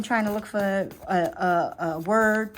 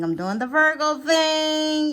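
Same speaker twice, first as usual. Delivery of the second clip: higher, slower mock Virgo sing-song; teasing; 7 semitones higher, slower than usual. Shown as a demonstration. Reader teasing Virgo viewers, in a higher slower sing-song. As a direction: tease Virgo about being fussy. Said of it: "Y'all know what the Virgo thing is".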